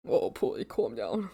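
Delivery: soft hushed calming; soothing. Real, not acted. Streamer soothing her pet Polly, softly. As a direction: hush the pet down gently.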